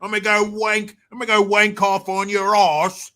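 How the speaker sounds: loud, broad fake Cockney; mock British accent. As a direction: ham up a Cockney threat. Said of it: "making fun of these damn accents".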